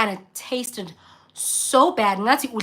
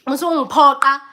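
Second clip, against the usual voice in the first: louder firm don't force me; retold protest; 3 semitones higher, 7 dB louder than usual. Guest refusing hosts, voice raised firmly. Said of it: "That's what I wanted to say".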